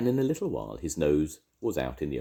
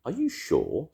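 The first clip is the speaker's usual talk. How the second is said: higher, rising question; still more surprised; 8 semitones higher, slower than usual. A narrator's reading. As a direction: ask it in growing surprise. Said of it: "still more surprised".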